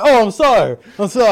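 loud, high mock apology through laughs; laughing mock apology. Co-host joking about his tight shirt, laughing mock apology. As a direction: play sorry while cracking up.

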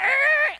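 very high, loud screeching "Er"; a screeching brake noise; mock screech. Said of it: "like, screech that happens".

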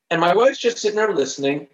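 normal talking voice

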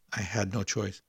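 ordinary delivery, nothing marked

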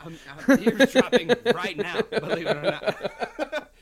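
words squeezed out through laughter, slowed; giggling through the admission; laughing. Real, not acted. To her co-host, laughing while admitting eavesdropping.

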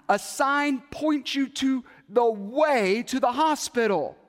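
impatient explaining. Retold or imitated. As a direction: spell out the obvious, annoyed.

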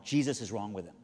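low, matter-of-fact statement; calm assurance. Retold or imitated. Wife calmly answers the frantic lady.

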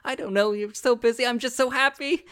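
high, climbing emotional voice; welling up with joy; happy, near tears. Acted. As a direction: tip toward happy tears. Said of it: "she starts crying again".

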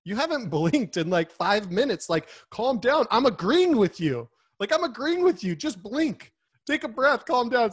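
high, increasingly loud mock exasperation; exasperated wife. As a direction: imitate a wife pleading for calm, pitch climbing. Wife's exasperated reaction acted out.

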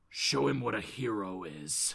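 deep, quiet appeal; Batman. Acted. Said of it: "Batman says to him".

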